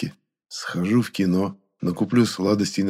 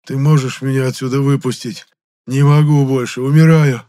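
высокий громкий страдальческий стон; умоляй, будто умираешь; голос умирающего лебедя; 6 semitones higher, 8 dB louder than usual. A narrator's reading. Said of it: "голосом умирающего лебедя". (надрывная мольба умирающего)

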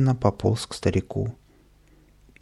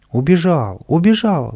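loud cry rising higher on the repeat; crowd shouting; 7 semitones higher, 5 dB louder than usual. A narrator's reading. Crowd finding the boy has escaped, shouting. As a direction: call out in alarm. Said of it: "закричали в толпе".

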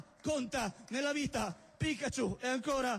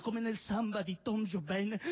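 ordinary talk

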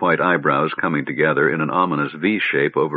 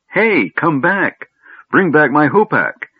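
high, drawn-out call; crying out; 9 semitones higher, 4 dB louder, slower than usual. A narrator's reading. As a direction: call after someone running off with your things. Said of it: "he cried as his staff".

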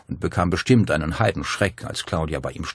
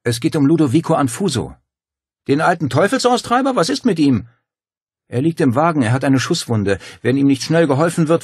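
higher, louder urgent plea; urgent pleading; 9 semitones higher, 7 dB louder than usual. A narrator's reading. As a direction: make her understand it's life or death. Claudia pleads urgently at door.

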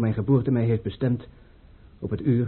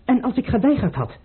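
shocked question; 12 semitones higher than usual. Acted. Prince learns troops already marched.

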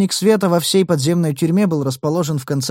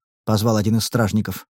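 plain reading voice

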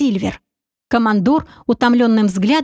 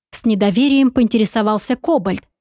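usual speaking voice

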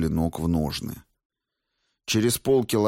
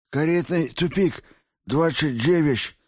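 high, mushy lisping voice; lisping injured thug; 7 semitones higher than usual. A narrator's reading. Beaten thug gives his boss's address, lisping. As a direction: answer through broken teeth. Smashed-mouth man answers under interrogation.

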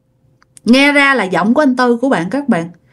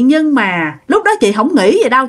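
ordinary talk

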